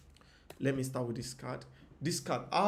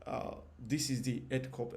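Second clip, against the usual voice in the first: normal talking voice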